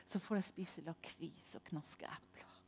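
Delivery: savoured whisper; hushed delight. Real, not acted. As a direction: whisper it like a little treat. Savouring her painting hours.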